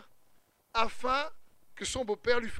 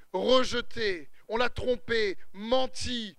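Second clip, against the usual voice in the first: usual speaking voice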